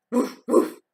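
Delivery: breathy imitated woof; a dog barking back. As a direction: bark like a dog. Dog answers Duck.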